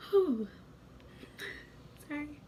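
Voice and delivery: holding back tears, voice catching; apologising while fighting back real tears; tearing up. Real, not acted. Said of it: "I'm about to tear up again".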